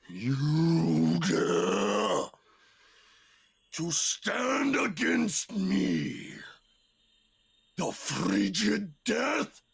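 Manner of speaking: slow, thundering challenge of the frigid death; booming monster challenge. Acted. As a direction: roar it as an ancient icy terror.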